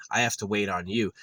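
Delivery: ordinary delivery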